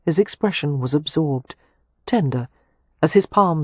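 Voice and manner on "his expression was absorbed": plain reading voice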